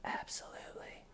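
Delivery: soft unvoiced mock whisper; whispered imitation of a denial; creepy whisper. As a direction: hush it like a creepy lie. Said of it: "going from just a normal talking voice".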